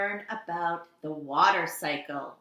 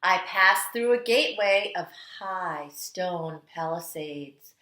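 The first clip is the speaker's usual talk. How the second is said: storytelling read-aloud; 3 dB louder than usual. Acted. Teacher reading The Water Dance to kindergarteners, dramatic storytelling. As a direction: read it like the water itself is dancing. Reads picture book aloud.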